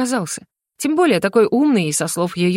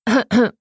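short deliberate throat clearing; small acted cough; 3 semitones higher than usual. A narrator's reading. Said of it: "Пришлось прокашляться".

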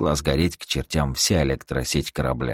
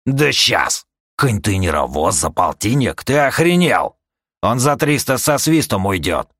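higher, loud bursts of indignation; scornful outrage; 6 semitones higher, 4 dB louder than usual. Acted. Seller rejecting fifty for the ship, indignant scorn. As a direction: scoff loudly at the insulting offer. Haggler scorns a lowball offer.

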